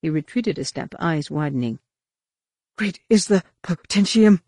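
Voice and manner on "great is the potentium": urgent, higher, louder recitation; hasty prayer. A narrator's reading. Roel retreating from Mara, hasty prayer. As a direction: recite it hurriedly, like a protective prayer. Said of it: "he whispered hastily, as though in prayer".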